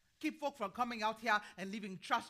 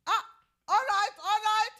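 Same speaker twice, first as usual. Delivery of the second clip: dismissive; 11 semitones higher, 12 dB louder than usual. Acted. Old woman brushing off interruption.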